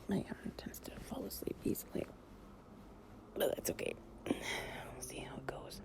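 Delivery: soft whisper. Acted. Lying close to a partner, drifting off, in a soft whisper.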